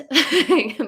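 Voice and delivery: high goat bleat. Pretend. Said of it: "that's my best impression of a goat".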